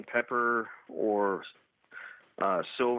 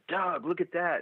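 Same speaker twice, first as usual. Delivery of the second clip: higher-pitched alert to a buddy; retold urgent call; 7 semitones higher, slower than usual. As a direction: get his attention, pitch lifted.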